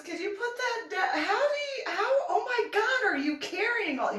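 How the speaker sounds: high, astonished voice; imitating Mike. Mike amazed at her carrying everything, high and astonished. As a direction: gasp at the suitcases in mock disbelief. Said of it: "Mike looks at me and goes".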